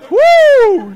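sudden high loud whoop. Acted. Pastor mock-cheering a silly church bulletin, loud high whoop.

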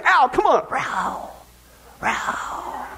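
weak half-hearted roar; mock lion roar. Host attempting the lion roar for the church joke. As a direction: try to roar and fizzle out. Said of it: "roar like a lion".

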